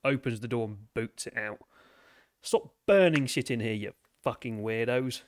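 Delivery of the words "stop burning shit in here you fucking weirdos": loud, annoyed telling-off; irritated shout, loudest at the start; Hammer. Acted. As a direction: yell crossly at the crew, then ease off. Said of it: "Hammer's not happy with this".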